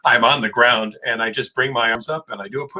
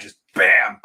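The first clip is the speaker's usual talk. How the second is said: ordinary talk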